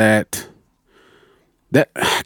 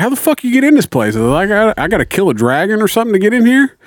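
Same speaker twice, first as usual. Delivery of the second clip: exasperated; 7 semitones higher, faster than usual. Retold or imitated. Texting friend, frustrated at locked ward.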